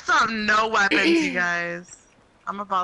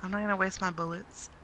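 usual speaking voice

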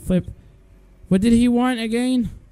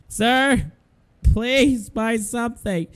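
normal talking voice

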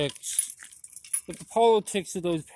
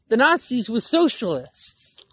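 normal talking voice

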